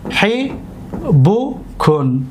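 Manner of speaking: حبوکن extremely slow, long pauses between syllables. Shown as a demonstration. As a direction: sound out حبوکن as hi, bu, kun. Teacher repeats word by syllables.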